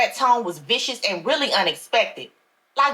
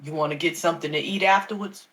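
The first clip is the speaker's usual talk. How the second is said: lower, smooth pastor voice; a deeper older preacher talking slyly; Pastor Wilson; 6 semitones lower, 4 dB quieter than usual. A narrator's reading. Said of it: "he asked".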